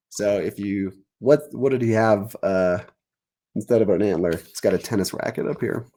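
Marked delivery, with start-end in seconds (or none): none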